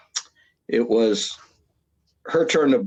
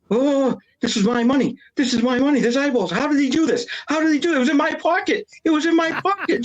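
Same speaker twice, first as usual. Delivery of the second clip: shrill, far higher hammed-up shriek of panic; mock hysterical screaming. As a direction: act her freaking out in a squealing high voice. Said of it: "she starts shaking and screaming".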